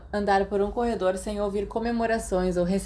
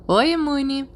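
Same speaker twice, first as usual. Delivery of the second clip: cheerful greeting; 6 semitones higher, 4 dB louder, slower than usual. Acted. James greets Remus cheerfully.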